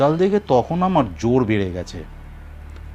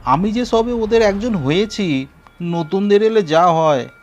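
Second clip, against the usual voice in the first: higher, meek plea; pleading thief; 8 semitones higher, 3 dB louder than usual. A narrator's reading. Thief begging the homeowner to listen, higher and meek.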